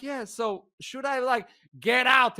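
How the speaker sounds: high, hesitant mock question; nervous compliance. Passenger checks the guard's order, nervously compliant.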